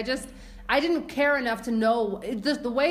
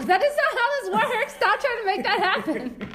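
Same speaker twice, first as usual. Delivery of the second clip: high, loud playful outburst with laughter; mock outraged protest; 12 semitones higher, 5 dB louder than usual. Cohost shutting down the other's attempted catchphrase, mock outraged. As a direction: protest loudly, pitched way up, breaking into laughs.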